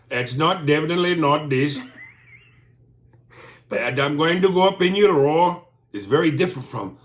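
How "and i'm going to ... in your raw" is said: louder, higher put-on British line; loud mock English accent; mock British come-on. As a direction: ham up a crude British accent. Mocking crude British line.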